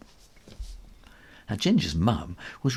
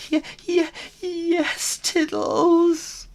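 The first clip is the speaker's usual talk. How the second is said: very high, louder frightened yes; scared little kitten; 6 dB louder, much slower than usual. Acted. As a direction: agree fast, terrified. Scared kitten agrees to bully.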